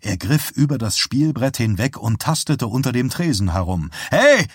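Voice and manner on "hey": sharp, high, loud cry; shouted protest. A narrator's reading. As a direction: shout to stop him. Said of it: "schrie der Schausteller". (empörter Schrei)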